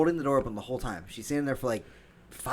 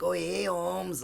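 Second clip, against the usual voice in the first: slow, higher mock Spanish accent; joke Spanish accent; 7 semitones higher, much slower than usual. Shown as a demonstration. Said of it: "I can't do a good Spanish accent".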